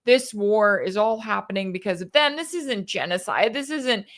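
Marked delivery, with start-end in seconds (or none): none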